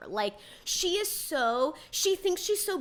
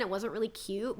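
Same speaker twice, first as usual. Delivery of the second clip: ordinary delivery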